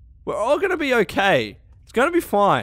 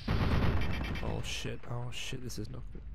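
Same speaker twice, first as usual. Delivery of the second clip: whispered, very soft alarm; hushed dread; 9 semitones lower, 13 dB quieter, slower than usual. Real, not acted. Gamer spotting a dangerous enemy, hushed dread. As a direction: breathe it out in dread. Sees a glowing one.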